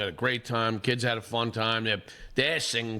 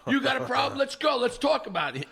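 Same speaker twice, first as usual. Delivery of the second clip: challenging shout; 12 semitones higher than usual. Retold or imitated. Catcher's father squaring up to the other dad, shouting. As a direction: square up, daring him. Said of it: "the catcher's father on this team goes".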